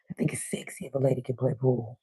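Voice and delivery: soft low whisper. Real, not acted. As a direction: confide it like a secret. Confessing a flirty opinion.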